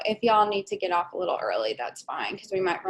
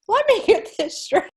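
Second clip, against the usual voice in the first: incredulous; 7 semitones higher, 6 dB louder, slower than usual. Real, not acted. Host's incredulous recap of the remarriage.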